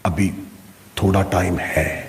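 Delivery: low, soft coaxing voice; lightly voiced lazy self, low and soft; lazy inner voice. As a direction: murmur it like a tempting voice inside. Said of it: "دوسری آواز آئی".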